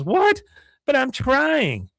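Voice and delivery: put-on high, slow whiny girl voice; Luann whine. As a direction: whine it, hurt. Luann hurt.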